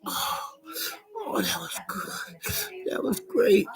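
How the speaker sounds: high, hushed mock relief; breathy satisfied sigh. Acted predator satisfied.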